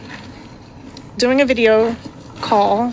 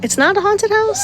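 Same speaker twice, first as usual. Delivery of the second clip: high, rising surprised question; 11 semitones higher than usual. Real, not acted. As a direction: ask back in surprise. Mom asks child, high.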